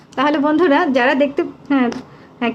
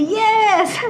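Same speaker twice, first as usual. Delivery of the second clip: high, drawn-out cheer; excited cheer; 7 semitones higher, much slower than usual. Retold or imitated. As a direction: read out an eager reply, stretched and high. Reads out viewers' eager replies. (উচ্ছ্বসিত টানা সুরে)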